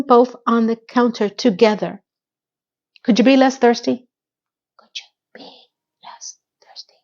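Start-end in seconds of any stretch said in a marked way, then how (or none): 4.8-6.9 very soft, voiceless whisper; whispered aside. Real, not acted. Repeats the remark in whisper.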